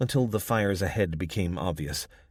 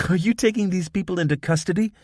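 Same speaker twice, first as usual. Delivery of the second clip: fast, higher, giggly Spanish-accented mockery; giggling; 12 semitones higher, 6 dB louder, faster than usual. Young woman mocking the cop to her comrade, fast giggly question. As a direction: tease him through a giggle. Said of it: "She giggled to her comrade".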